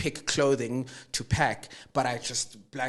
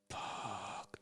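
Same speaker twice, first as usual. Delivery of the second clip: breathy whispered swear in dread; whispered curse; 3 semitones lower, 22 dB quieter than usual. Retold or imitated. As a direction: let the curse slip out in a near-silent whisper. Hearing sister's early call, dread.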